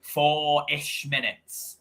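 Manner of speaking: lively football commentary, pushing on the chances; commentating the final minutes for the viewers, eager play-by-play. Acted. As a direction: call the match like a TV commentator. Does own live match commentary.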